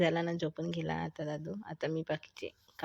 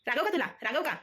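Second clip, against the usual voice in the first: high playful mock telling-off of "रागव का तुला"; pretend-stern question to the baby, pitched up; mock scolding; 8 semitones higher, much faster than usual. (लटक्या रागात बाळाला विचारणे)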